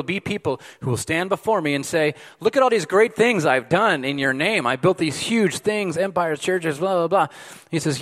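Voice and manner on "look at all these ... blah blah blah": raised, louder voice listing achievements; higher-pitched showing-off, trailing into dismissive filler; mock boasting. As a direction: lightly act out a self-important boaster. Voicing boasters pleading their achievements.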